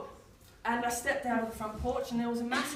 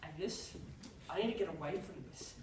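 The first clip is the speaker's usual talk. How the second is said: quiet plea; 6 semitones lower, 11 dB quieter than usual. Retold or imitated. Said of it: "Isaac said".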